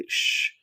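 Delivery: a sharp hush; shushing. Acted. Husband hushing his crying wife at night, sharp shush. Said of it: "उसे चुप कराते हुए कहा".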